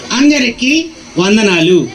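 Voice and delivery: slow, drawn-out, proper greeting; formal greeting. Shown as a demonstration. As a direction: greet everyone slowly and ceremoniously. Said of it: "పద్ధతిగా అందరికీ వందనాలు అని చెప్పాలి". (పద్ధతిగా నెమ్మదిగా వందనాలు)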